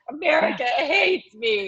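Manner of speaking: high, slow, tearful whimper; retold crying. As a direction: replay the tearful moment. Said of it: "I was crying when I got that".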